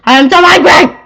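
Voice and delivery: loud, high-pitched raging shout; angry roar. Acted. Baron pacing and stomping around, angry roar. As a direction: roar it out in a temper. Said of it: "roared Baron von Grump".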